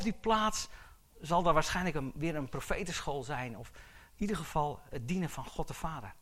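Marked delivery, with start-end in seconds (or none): none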